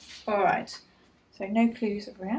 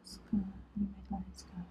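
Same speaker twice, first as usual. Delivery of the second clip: whispering; 2 semitones lower, 12 dB quieter, slower than usual. Real, not acted. Noting findings under breath.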